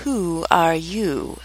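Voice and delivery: slow, drawn-out sleepy drawl; languid, sleepy. A narrator's reading. The caterpillar first addresses Alice, languid and sleepy. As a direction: draw each word out drowsily and softly. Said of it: "languid, sleepy voice".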